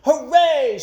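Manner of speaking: loud, high hooray; cheering shout. A narrator's reading. Crowd cheering Mike Mulligan, loud hooray. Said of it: "shouted the people".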